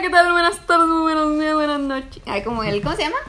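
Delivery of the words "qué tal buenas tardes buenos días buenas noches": big loud showman welcome; loud TV host greeting. Retold or imitated. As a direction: boom it out like a TV host. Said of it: "¿El show de Truman?".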